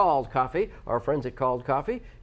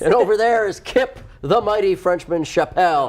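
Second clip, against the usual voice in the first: higher, louder showman build-up for Kip; grand introduction; 6 semitones higher, 7 dB louder than usual. Acted. Host introducing Kip to listeners, showman voice. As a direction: announce him like a ring announcer.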